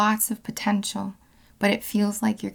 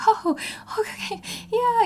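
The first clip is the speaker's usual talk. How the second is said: put-on relieved kid; 13 semitones higher than usual. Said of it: "'cause he's just like".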